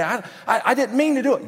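high, loud mock pleading excuse; defensive protest. Someone excusing an angry outburst, high and defensive. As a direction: protest your innocence, pitched right up.

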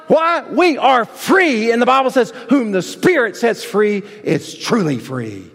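high, punchy pulpit delivery; rousing sermon exhortation; impassioned preaching. Real, not acted. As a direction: preach it with full force, hammering the key words. Preaches at full force.

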